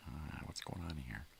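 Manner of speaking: a baffled pet asking; dog's voice. Pretend. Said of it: "He's like".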